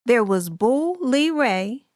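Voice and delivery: ordinary delivery, nothing marked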